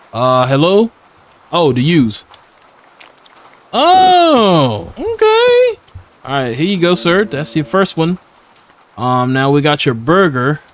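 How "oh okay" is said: high, sudden startled oh okay; startled squeal. Real, not acted. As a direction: jump up into a squeaky surprised okay. Startled by game event.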